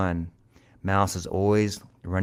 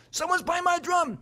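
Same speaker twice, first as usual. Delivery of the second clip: very high-pitched frantic cat cry; alarmed cat. A narrator's reading. Cat running back to the house, high alarmed cry. Cat runs home crying out.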